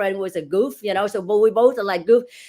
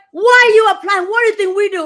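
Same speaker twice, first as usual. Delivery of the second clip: much higher, louder mock challenge; mock stern interviewer; 11 semitones higher, 11 dB louder than usual. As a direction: bark the question like a gruff official. Interviewer's challenge voiced in story.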